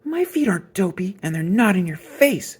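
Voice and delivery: put-on high, indignant little-girl voice shouting back; a hint of a girl's yell. A narrator's reading. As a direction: fire it straight back at him. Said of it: "she shouted back".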